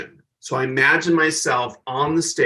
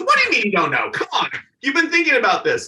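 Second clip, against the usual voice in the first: higher, pushy disbelief; incredulous Joan Rivers; 9 semitones higher, faster than usual. Retold or imitated. A star pushing the nervous opener, higher and incredulous. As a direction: come on, push him. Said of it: "She goes".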